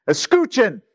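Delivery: Escuchen said in Spanish, high and clipped to a stop; Spanish word. Shown as a demonstration. Preacher translating listen into Spanish, a high Spanish command. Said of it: "Let me say it in Spanish".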